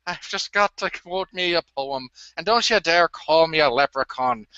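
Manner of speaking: exaggerated stage Irish accent; hammed-up Irishman slipping into an accent; mock Irish accent. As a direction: put on a lilting, indignant Irish brogue. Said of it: "you've got an Irish accent or you don't".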